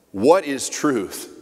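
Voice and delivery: slow, dismissive retort. A narrator's reading. Pilate retorting to Jesus at the trial, scornful and slow. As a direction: throw the question back, scornful and slow. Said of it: "retorted Pilate".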